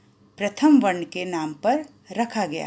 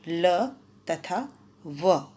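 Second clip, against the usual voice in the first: slow demo; 4 dB quieter, much slower than usual. Shown as a demonstration. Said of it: "संख्या में चार हैं".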